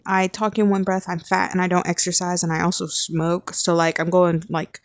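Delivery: normal talking voice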